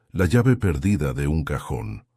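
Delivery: plain reading voice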